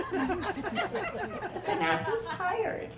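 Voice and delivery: slow, soft, high laughing drawl; laughing mockery. Real, not acted. Comic teasing husband's nap, slow and laughing. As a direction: laugh through mock pity.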